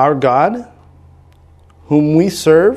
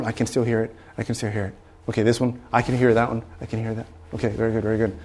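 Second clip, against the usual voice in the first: muttered self-talk; 6 semitones lower, 11 dB quieter than usual. Retold or imitated. Quietly reassuring himself during test.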